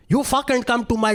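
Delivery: high-pitched mock rant, Indian accent; angry Indian accent. Neighbour arguing next door, mock-angry Indian accent. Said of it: "the neighbor was going".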